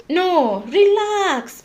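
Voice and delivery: loud, high call to settle down; mock scolding. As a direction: cut in loudly and stretch it out. Telling off an imagined macho listener.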